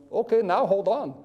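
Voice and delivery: put-on Indian accent. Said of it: "in his best Indian accent".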